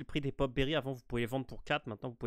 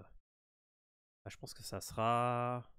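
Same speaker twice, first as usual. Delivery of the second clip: normal talking voice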